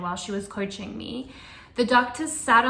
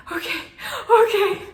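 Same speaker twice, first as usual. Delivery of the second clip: startled okay; 11 semitones higher, 8 dB louder than usual. Retold or imitated. Panicked reply to the midwife.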